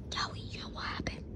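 unvoiced secret whisper; whispered secret between pet and owner. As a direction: lean in and whisper it.

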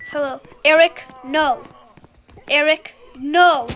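loud scolding call; scolding loudly. Real, not acted. Scolding game character.

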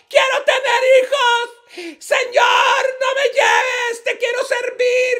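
very high, loud wailing plea; súplica desesperada. Acted. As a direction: beg God at the top of your voice in a high, shouted cry. Acted plea of dying woman to God.

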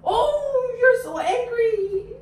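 high, wailing pretend alarm; playful, drawn-out dread; mock fright. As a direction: act scared of a child's angry face. Mock fright at children's angry faces.

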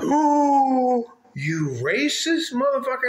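high, drawn-out mock gasp into an insult; imitated outrage. Offended woman answering his insult, imitated outrage.

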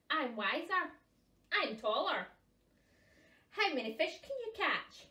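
loud, swaggering brag; boastful puffin. Acted. Puffins competing for the name, loud bragging. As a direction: puff yourself up, voice the rival.

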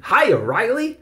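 high, loud passing hello; called-out greeting. A narrator's reading. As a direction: call it out with a smile. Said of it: "Some smiled and called out".

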